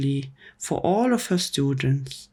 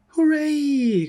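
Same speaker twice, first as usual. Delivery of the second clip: high, loud cheer; 12 semitones higher, 4 dB louder than usual. A narrator's reading. Children cheering at the teacher's news, high and loud. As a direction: whoop it up like a class of kids. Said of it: "cried the children".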